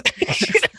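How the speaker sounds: high unvoiced giggle; a breathless burst of laughter; airy laugh up high; high breathy laugh. Real, not acted. Laughing at the angry memory.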